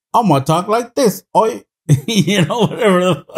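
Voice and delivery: high, loud cockney oi voice; mock British accent. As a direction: ham up a bad British accent. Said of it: "free reign to just like".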